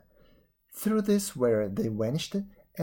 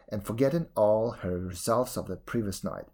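usual speaking voice

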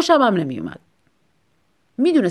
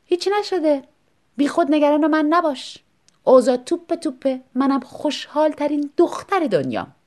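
higher, falsely cheerful sarcasm; sarcastic; 7 semitones higher than usual. Acted. Wife mocking husband's question, falsely cheerful sarcasm. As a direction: chirp it brightly but mean the opposite.

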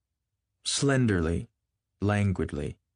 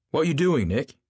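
loud demand; 8 semitones higher, 5 dB louder than usual. Acted. Tom's sudden loud question.

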